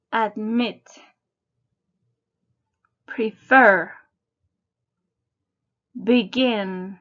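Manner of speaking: "Admit", "Prefer", "Begin" each hit on the second syllable, ad-MIT, pre-FER, be-GIN; stress on second syllable. Shown as a demonstration. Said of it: "Notice the second syllable is stressed".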